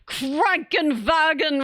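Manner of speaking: slow, drawn-out mock-angry German word; mock angry scream. Streamer mocking meme videos, mock angry scream. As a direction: imitate a meme's needlessly angry German. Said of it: "why are you screaming it".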